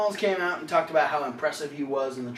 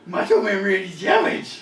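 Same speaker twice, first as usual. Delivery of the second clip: Macho Man voice; 4 semitones higher, 5 dB louder than usual. Acted. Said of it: "Scooby-Doo and Macho Man".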